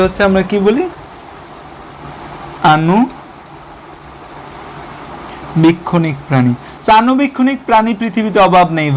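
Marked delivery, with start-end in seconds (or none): 2.8-6.5 slow drawn-out answer. Shown as a demonstration. Teacher answers own question slowly.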